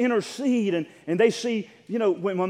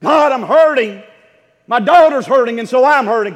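louder, higher God, I'm hurting; pained cry; 3 semitones higher, 9 dB louder than usual. Acted. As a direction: let the hurt break out loud. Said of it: "she was saying".

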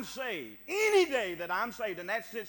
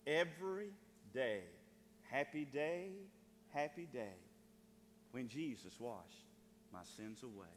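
hymn recitation; 9 semitones lower, 11 dB quieter than usual. Acted. Preacher closing a hymn verse to the congregation, hushed recitation. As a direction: let the refrain sink softer and lower.

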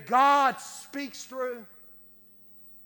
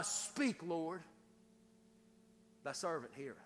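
near-whispered, reverent answer to God; soft reverent murmur; hushed prayer; 6 semitones lower, 11 dB quieter than usual. Retold or imitated. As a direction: breathe it softly, like a boy answering God in the dark. Samuel's hushed reply to God.

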